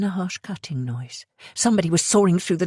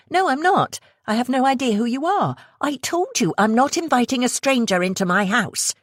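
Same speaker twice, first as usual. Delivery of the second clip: put-on firm young woman refusing; 8 semitones higher than usual. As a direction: shut the stranger down. Sophia refusing the stranger.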